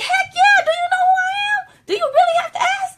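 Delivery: high, loud, thrilled yell; thrilled shout. Retold or imitated. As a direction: squeal it like you can't believe your luck. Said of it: "I was like".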